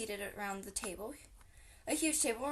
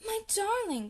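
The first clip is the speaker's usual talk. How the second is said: much higher, delighted call; joyful cry; 10 semitones higher, 5 dB louder than usual. A narrator's reading. Mrs Fox jumps up to greet her returning husband, high and joyful. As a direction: greet him with relief and joy. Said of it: "cried Missus Fox".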